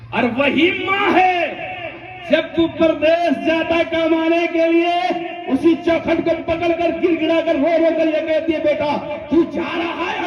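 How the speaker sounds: slow, high, tearful-edged preaching; impassioned preaching. Acted. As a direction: plead slowly, voice lifted. Emotional preaching about mother. (ماں کا ذکر کرتے ہوئے آہستہ اونچی جذباتی آواز)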